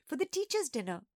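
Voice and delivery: put-on high little girl's voice; squeaky child's explanation; squeaking. A narrator's reading. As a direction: pipe up helpfully as a little sister. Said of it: "a familiar voice squeaked".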